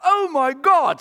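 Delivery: excited gush. Acted. Fan runs up to Jesus, squealing with excitement. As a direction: gasp it like a starstruck fan. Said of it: "I can't even say this properly".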